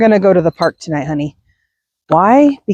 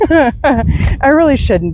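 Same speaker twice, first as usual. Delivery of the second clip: laughing through the words, higher; guilty laughing; 6 semitones higher, much slower than usual. Real, not acted. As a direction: try not to laugh and fail. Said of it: "I shouldn't laugh".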